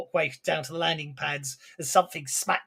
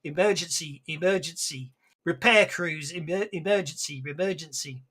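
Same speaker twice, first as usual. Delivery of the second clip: usual speaking voice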